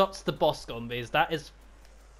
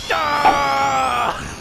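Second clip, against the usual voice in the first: loud high charging yell; battle cry yell; 8 semitones higher, 9 dB louder than usual. Real, not acted. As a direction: roar as you attack. Attack on count of three.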